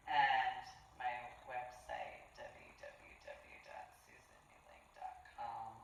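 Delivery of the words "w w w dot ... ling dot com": breathy whispered web address; whispering. Real, not acted. Website repeated as a whisper.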